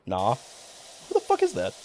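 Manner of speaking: very high, slow incredulous squeal; stunned high-pitched who; shocked disbelief. Real, not acted. Shocked by picture.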